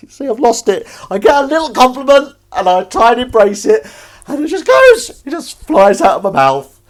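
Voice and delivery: high, loud laughing squeal; words spill out through laughter; losing it laughing. Real, not acted. Praised by chat, he laughs uncontrollably while talking. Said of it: "I've lost it now".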